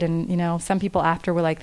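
normal talking voice

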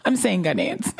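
Ghanaians said with a laugh, Ghanaian way. Shown as a demonstration. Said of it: "an accent can change everything".

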